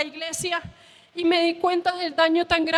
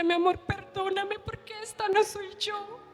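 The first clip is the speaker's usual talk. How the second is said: softer and higher, gentle and hushed; hushed, loving plea for forgiveness; tender apology; 5 semitones higher, 4 dB quieter than usual. Retold or imitated. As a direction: murmur it over a sleeping child. Caressing sleeping son, apologizing.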